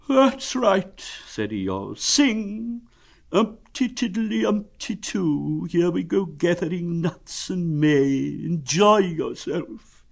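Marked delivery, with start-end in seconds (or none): 0.1-1.0 high, loud, aggrieved; high-pitched doleful donkey voice; gloomy donkey. Acted. Donkey responding to Pooh's song, gloomy. 2.0-10.1 high, slow mock merriment; drawn-out doleful sarcasm; gloomy donkey. Donkey telling Pooh to go on singing, gloomy.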